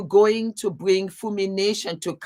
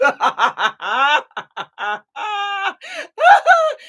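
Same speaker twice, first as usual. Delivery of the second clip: high, loud laughing shout; shrieking laugh; 13 semitones higher, 6 dB louder than usual. Real, not acted. Still cracking up at the comments, high shrieking laugh.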